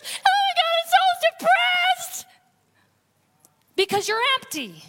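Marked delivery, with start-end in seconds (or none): 0.0-2.2 high, loud hammed-up wail of despair; mock despairing wail. Preacher mocking a drained churchgoer, high loud wail. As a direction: wail it like a burnt-out helper in melodrama.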